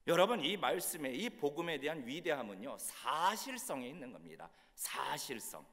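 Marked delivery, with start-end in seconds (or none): none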